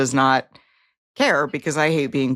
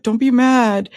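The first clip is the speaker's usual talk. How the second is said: louder, higher mocking plea; 6 semitones higher, 4 dB louder, slower than usual. Friend mocking the manipulative phrase, louder and higher. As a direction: parody the manipulative line.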